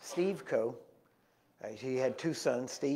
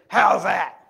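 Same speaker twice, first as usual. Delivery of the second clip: loud, raised preacher bellow; big old voice; 5 semitones higher, 14 dB louder than usual. Retold or imitated. Preacher letting go of the woman, booming question. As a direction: boom the question out like a revival preacher. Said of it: "he had this big old voice".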